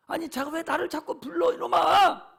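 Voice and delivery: exasperated father, loud burst at the end; hammed-up scolding, building to a yell; mock irritated retort. Voices God irritated by repeated calling. (짜증 난 척 버럭)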